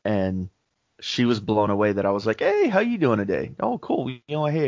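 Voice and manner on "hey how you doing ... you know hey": much higher, bright friendly hello; cheery greeting. Retold or imitated. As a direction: greet her like an old friend, way up high. Cheerful greeting to service lady.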